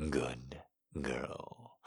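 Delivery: low, hushed, rising praise; low murmured praise. A narrator's reading. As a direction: purr it softly and low.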